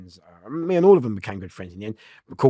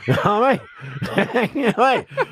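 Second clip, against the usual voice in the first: laughing; 11 semitones higher than usual. Real, not acted. Laughing at own punchline.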